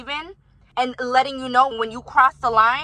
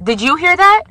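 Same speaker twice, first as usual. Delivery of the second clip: louder and higher, outraged; indignant outburst; 6 semitones higher, 11 dB louder than usual. Real, not acted. Asking the viewers, loud and higher, outraged. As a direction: throw the question out, incredulous. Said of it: "Did you hear how I just said".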